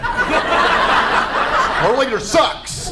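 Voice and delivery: mock North Korean, southern twang; North Korean with southern accent. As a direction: moan it in a hammed-up southern accent. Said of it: "my imitation of a North Korean right there with a southern accent".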